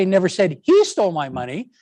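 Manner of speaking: he punched, rest plain; stress on HE. Shown as a demonstration. Teacher showing it was someone else, stress on he. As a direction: lean on he and let the line rise. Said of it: "then I'm saying".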